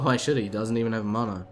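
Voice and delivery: ordinary talk, nothing marked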